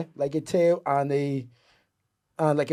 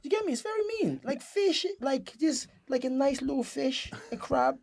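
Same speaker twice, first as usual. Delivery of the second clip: squeaky raised voice; high-pitched; 12 semitones higher, 3 dB quieter than usual. Real, not acted. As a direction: pitch it way up in protest. High excited complaint.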